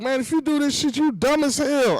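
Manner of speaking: high-pitched mock yell; retold yelling. Telling off a friend about to be scammed, mock yelling. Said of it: "legit yelling at her".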